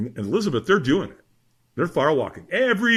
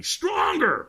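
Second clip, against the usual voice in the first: high, strained mock scream; retold scream. Trainer in the firewalker's face, high mock scream. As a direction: imitate a trainer screaming, without full volume. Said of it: "he screamed at me".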